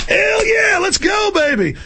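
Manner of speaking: shouted very high whoop; mock whooping soldier. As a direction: holler like a thrilled thug soldier. Soldier whooping after execution.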